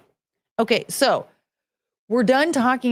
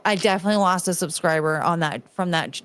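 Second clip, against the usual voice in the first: ordinary delivery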